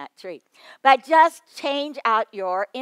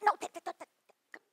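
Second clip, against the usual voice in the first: calling him back; 9 semitones higher, 7 dB quieter than usual. Retold or imitated. Calling after him again.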